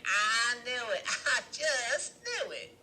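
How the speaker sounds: high, slow words through laughter; laughing triumph. A narrator's reading. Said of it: "we can hear her laughing and saying".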